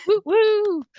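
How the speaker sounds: high, loud cheering whoop; excited whoop. Real, not acted. Host cheering the last two poets, excited whoop.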